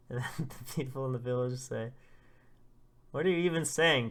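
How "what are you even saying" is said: loud, higher-pitched disbelief; acted exasperation. Villagers answering a panicking father, acted loud exasperation. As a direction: call back in exasperated disbelief. Villagers react to his shouting.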